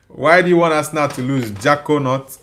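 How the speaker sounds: ordinary talk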